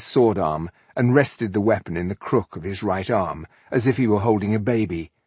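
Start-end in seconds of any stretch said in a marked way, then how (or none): none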